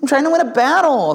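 put-on high, drawn-out childish pleading; hammed-up child's whine; whiny kid. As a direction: beg like a pestering little kid. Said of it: "You know how kids do".

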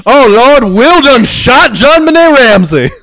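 loud, high, slow exaggerated preacher wail; Steve Harvey voice. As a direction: wail it out like a shocked game show host.

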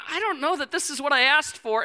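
high, uneasy mock protest; acted mounting worry. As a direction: fret aloud as the alarm grows. Acting out alarm at the mirror.

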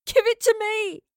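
high, loud pleading shout; tearful shout. A narrator's reading. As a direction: shout the demand through tears. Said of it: "she shouted through returning tears".

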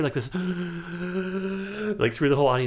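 ordinary talk

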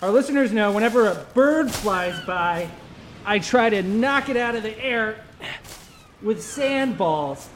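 high, strained comic persona voice; high character voice. Acted. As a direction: squeak it out like a cartoon castaway. High put-on voice held.

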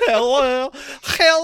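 put-on high, loud parody Quebecois accent; mock Quebecois accent. Co-host echoing the host's accent, high mock Quebecois. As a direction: mimic a silly Quebecois accent. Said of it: "Is that how they talk?".

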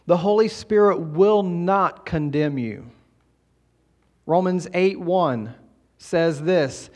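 usual speaking voice

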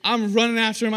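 normal talking voice

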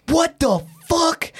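high, drawn-out outburst, acted out; outraged security guard. Security guard reacting to a traveler's bag, outraged mimicry. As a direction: mimic a disgusted guard in a high, slow cry. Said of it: "I hear the security guard going".

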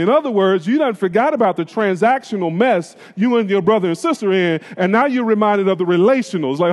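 usual speaking voice

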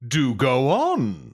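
slow, lofty do go on; slow dismissive. Retold or imitated. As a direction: drag it out, bored and posh. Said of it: "just say".

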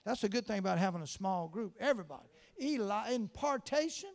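loud fervent prayer at full force; preaching prayer. Real, not acted. Preacher praying over the small group, fervent and loud. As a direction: pray it out with fire.

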